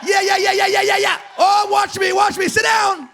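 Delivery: high, loud pulpit exclamations; fired-up preacher shouting. Real, not acted. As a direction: whoop it up from the pulpit.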